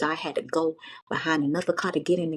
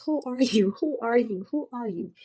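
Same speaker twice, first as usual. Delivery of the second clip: confused repeat; 5 semitones higher than usual. Retold or imitated. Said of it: "The only thing she kept saying".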